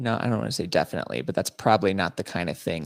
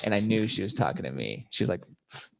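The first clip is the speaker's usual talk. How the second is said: ordinary talk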